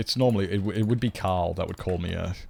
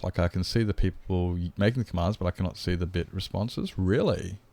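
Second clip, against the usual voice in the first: normal talking voice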